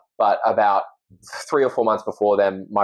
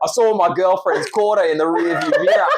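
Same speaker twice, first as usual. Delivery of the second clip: high, lively Australian accent; Australian demo with a laugh in it; 7 semitones higher, 3 dB louder than usual. Shown as a demonstration. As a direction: say it in broad Australian, pitched up. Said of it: "now do it in Australian".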